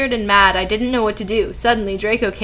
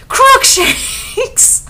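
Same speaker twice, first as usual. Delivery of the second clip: very high, drawn-out mock incantation; hammed-up shout; 5 dB louder than usual. A narrator's reading. Narrator casting at Voldemort, in a hammed-up high shout. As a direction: squeal it out like a ridiculous spell. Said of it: "I shouted at him".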